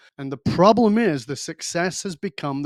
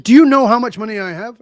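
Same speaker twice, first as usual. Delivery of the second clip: louder, higher, indignant; offended billionaire; 6 semitones higher, 9 dB louder than usual. Retold or imitated. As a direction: bristle with wounded pride. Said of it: "And he said".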